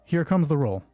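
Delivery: ordinary talk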